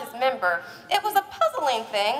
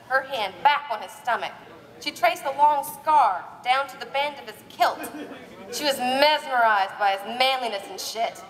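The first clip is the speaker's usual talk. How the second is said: ordinary talk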